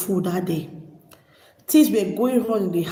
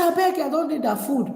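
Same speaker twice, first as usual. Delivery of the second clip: higher-pitched retold refusal; imitated dismissive mother; 6 semitones higher than usual. Mother refusing daughter's future food, imitated dismissive retort. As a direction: wave the offer away in a raised voice.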